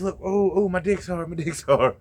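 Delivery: high mock ooh of a guy enjoying it; hammed-up moaning; lightly voiced friend moaning with delight; mock pleasured moan. [name] moaning after whip.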